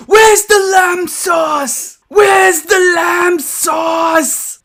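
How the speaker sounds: furious high, drawn-out chef yell; Chef Ramsay yelling. Acted. Reviewer mimicking an angry TV chef at a lookalike figure, yelling. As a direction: bellow each word slowly at full volume, high-pitched. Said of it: "gonna yell at me any second".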